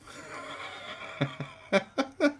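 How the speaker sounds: rising breathy laugh; laughing at the absurd idea; high airy chuckle; high breathy giggle. Real, not acted. Laughs at own plan.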